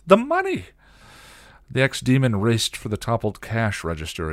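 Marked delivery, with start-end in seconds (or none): 0.0-0.7 very high, loud shriek; horrified scream. A narrator's reading. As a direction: shriek in panic over a loss. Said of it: "Anya screamed".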